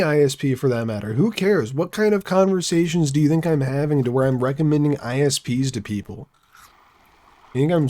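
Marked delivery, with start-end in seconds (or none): none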